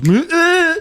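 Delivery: high, loud squelching bleat; imitated stomach noise. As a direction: mimic the stomach letting go. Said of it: "stomach literally just goes".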